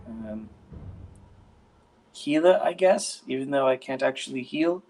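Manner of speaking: a hint of a timid healer; lightly voiced young woman. GM voices NPC Augusta.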